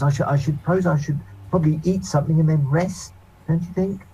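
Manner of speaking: fussy gentleman voice. Acted. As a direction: sniff at the tavern, politely. Same NPC continues.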